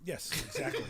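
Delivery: high, loud laughing "exactly"; laughing agreement. Real, not acted. Agreeing with friend's Olive Garden joke, laughing. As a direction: burst out agreeing.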